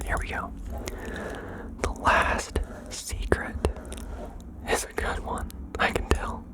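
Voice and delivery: ordinary talk, nothing marked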